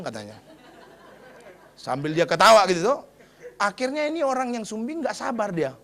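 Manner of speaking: normal talking voice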